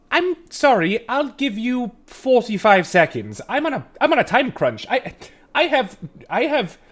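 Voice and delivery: high, loud, fussy little gnome; a busy gnome who has no time to spare; harried gnome voice. Acted. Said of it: "he kinda squints at you and he goes".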